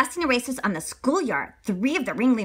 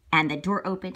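ordinary delivery, nothing marked

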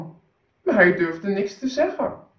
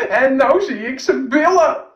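put-on high, loud child's cry; little boy shouting; 5 semitones higher, 8 dB louder than usual. Little boy shouts at the passing emperor, high and loud. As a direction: blurt it out like a small boy. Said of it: "het jongetje riep".

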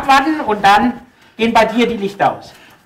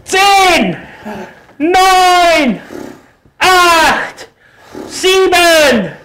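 referee count; 7 semitones higher, much slower than usual. Acted.

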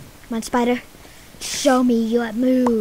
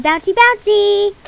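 gleeful squeal; 7 semitones higher, 6 dB louder than usual. Pretend. Bouncing slime, gleeful.

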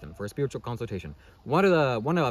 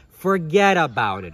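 loud, slow, raised brush-off; emphatic dismissal; 4 semitones higher, 8 dB louder, slower than usual. Real, not acted. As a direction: wave the sceptic off loudly, dragging each word. Dismissing the scientific evidence sceptics.

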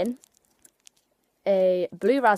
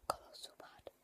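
whispering; 21 dB quieter than usual. Real, not acted. After sour candy.

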